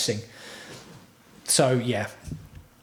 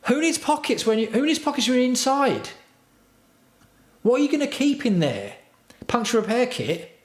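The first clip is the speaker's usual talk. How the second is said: much higher sarcastic squeak; mocking voice; 11 semitones higher than usual. Streamer ridiculing pockets on an indoor jersey, high mocking squeak. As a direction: lampoon the designers in a high put-on voice.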